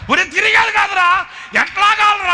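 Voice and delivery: very high loud calling; panicked shouting. Retold or imitated. Preacher yelling as the handle spins him, high loud. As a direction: yell for help while spun round.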